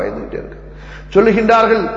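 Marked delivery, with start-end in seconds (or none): 0.0-0.7 hushed, breathy whisper of fear. Acted. Preacher telling of the companions' fear, whispered. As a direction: let the words sink to a whisper.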